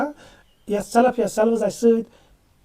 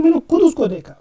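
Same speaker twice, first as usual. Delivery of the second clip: खुद hit louder and higher with a falling end; stress on खुद; 6 semitones higher, 6 dB louder than usual. Shown as a demonstration. Teacher reassuring students with a louder, higher खुद. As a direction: assure them you did it yourself, weight on खुद. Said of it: "मैं आपको यकीन दिला रहा हूँ".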